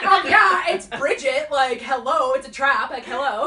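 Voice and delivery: sassy reply. Retold or imitated. Boyfriend answering fans as Bridget.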